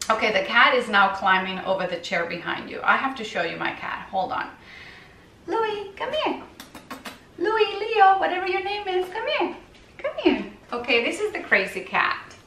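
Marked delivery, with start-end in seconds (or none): 5.5-10.4 calling the cat in a high, sing-song voice; high coaxing call. Real, not acted. Owner calling her kitten in a high coaxing voice. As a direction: coax the kitten over, pitched way up.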